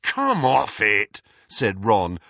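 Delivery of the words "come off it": loud, slow, drawn-out disbelief. A narrator's reading. Ron to Harry and Hermione, in loud disbelief. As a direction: scoff as if they must be joking. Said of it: "looking in disbelief".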